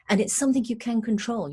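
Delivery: usual speaking voice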